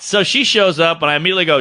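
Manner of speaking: usual speaking voice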